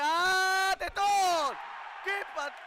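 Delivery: high, loud burst of excitement; excited save call. Real, not acted. Save called in penalty shootout.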